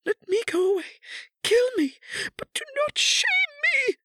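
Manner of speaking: very high, loud, tearful plea; anguished begging. A narrator's reading. Kneeling wife begs her husband, anguished and tearful. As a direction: beg desperately in a high crying voice.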